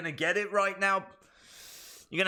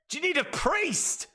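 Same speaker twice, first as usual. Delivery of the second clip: mock concerned helper; 10 semitones higher than usual.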